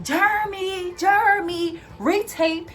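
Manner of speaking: high, carrying call for a coworker; re-enacted calling out. Worker calling the passing supervisor to retape, loud high call. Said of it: "'cause my mouth is loud".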